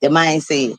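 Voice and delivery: usual speaking voice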